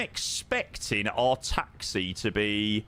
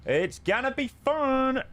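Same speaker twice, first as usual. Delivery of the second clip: excited; 12 semitones higher, slower than usual. Real, not acted. Streamer hyping the arrival.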